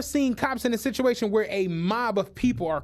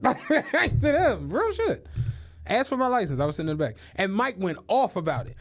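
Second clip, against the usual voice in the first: ordinary talk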